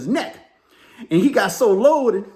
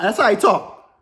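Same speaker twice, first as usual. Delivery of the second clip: ordinary delivery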